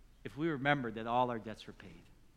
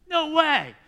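high, loud burst of excitement; imitating a boy's astonished delight; amazed disbelief; 13 semitones higher, 15 dB louder than usual. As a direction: blurt it out like a thrilled kid getting a gift. Retells boy receiving birthday gift.

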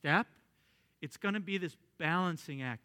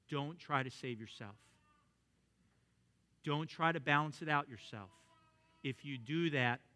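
quiet instructions; 4 semitones lower, 8 dB quieter than usual. Retold or imitated. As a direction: calmly instruct the man on his back. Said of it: "this is the instructions he gave him".

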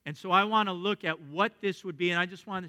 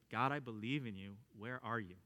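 quiet plea; 7 semitones lower, 10 dB quieter than usual. Retold or imitated. Doubting prayer voiced in quiet.

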